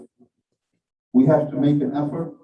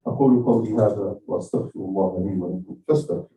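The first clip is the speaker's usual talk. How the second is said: fast, lower liturgical Arabic formula, run together; rapid Arabic recitation; 6 semitones lower, much faster than usual. Acted. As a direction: rattle off the closing prayer formula quickly. Closing Arabic sermon formula.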